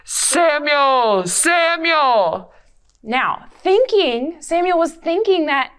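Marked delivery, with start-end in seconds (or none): 0.0-2.5 lightly voiced God, drawn out. Shown as a demonstration. Storyteller cues the class with a mild God voice. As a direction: call his name slowly like God. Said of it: "Remember the line for God?".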